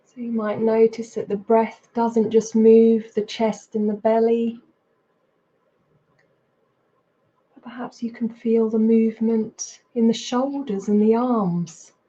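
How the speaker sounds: unhurried meditation guidance with long pauses; guided meditation. Real, not acted. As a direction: guide them into body and breath, leaving space between phrases. Leads a mindfulness practice.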